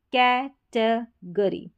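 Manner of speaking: कैटेगरी very slow, in three pieces "kai-te-gree"; syllable by syllable. Shown as a demonstration. Teacher reading a word to learners, कैटेगरी slowly broken up. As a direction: stretch and separate the syllables of कैटेगरी.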